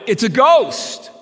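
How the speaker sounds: high, loud, slow cry of terror; frightened cry. A narrator's reading. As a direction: cry out in fear of a ghost. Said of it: "they cried out".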